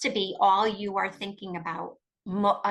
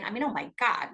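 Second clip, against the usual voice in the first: ordinary talk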